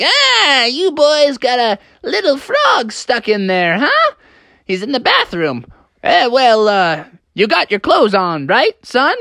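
high, odd character voice; weasel janitor. Acted. Weasel janitor questions the helpers and the trapped frog, high-voiced. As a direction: play a weasel janitor sizing things up. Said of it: "the janitor came up and was like".

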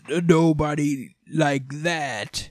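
slow, strange distorted demon voice; lightly voiced warped villain; distorted Dante. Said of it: "distort and just go like".

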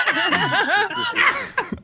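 long squealing laugh; high-pitched giggling fit; high cackle. Real, not acted. Laughing at his own nerd jibe, high cackle.

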